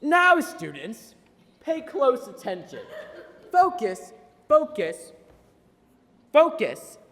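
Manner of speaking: loud, slow schoolmarm drill; stern teacher. Retold or imitated. Teacher Miss Acosta lecturing her second graders, stern and loud. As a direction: lay down the law to the class. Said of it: "she said".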